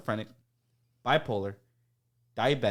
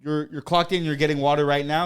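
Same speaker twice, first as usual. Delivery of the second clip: louder boss's challenge; mock indignant boss; 4 semitones higher, 7 dB louder than usual. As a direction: question it in loud disbelief. Acted boss challenging him.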